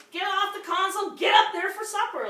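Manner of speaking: put-on high, loud shout at the kids; yelling at the kids. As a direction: bellow at them to get off the console. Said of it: "and I yell at them".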